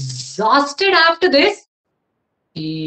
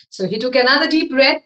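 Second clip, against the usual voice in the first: ordinary delivery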